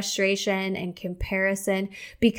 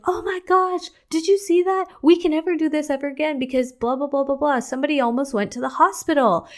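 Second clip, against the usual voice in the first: high-pitched, rushed mock panic; freaking out; 7 semitones higher, faster than usual. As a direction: act out an anxious freak-out, high and fast. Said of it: "could have freaked out and been like".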